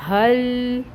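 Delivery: حل recited smoothly, slightly chanted; حل in recitation tone; joined recitation. Shown as a demonstration. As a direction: join ha to lam as in tilawat. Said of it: "تو یہ بنے گا".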